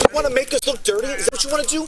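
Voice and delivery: loud, real scolding; forceful, heated confrontation; angry dressing-down. Real, not acted. Officer berates the detained man.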